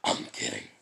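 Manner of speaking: very deep beast growl; second go at a deep monster voice; Kurama. Shown as a demonstration. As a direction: growl it out low and strong. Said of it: "my Kurama voice isn't as good".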